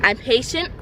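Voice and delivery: plain reading voice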